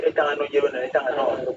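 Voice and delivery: quoting a proverb in his own language. Retold or imitated. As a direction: recite the saying in his mother tongue. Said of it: "In my language, they say".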